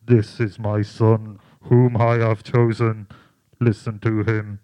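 a deep booming divine voice; voice of God from the cloud. Acted. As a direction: boom it down from the heavens. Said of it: "A voice came from the cloud saying".

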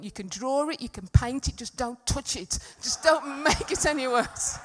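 voiced rant, slowing to emphatic words; inner desperate plea. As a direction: let the silent begging out in full voice, stretching the last orders. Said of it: "inside of me, I'm going".

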